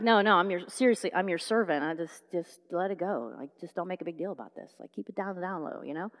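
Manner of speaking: very soft, low, self-effacing; embarrassed hushing. Acted. Son begging his father not to fuss, hushed. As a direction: play it right down under your breath.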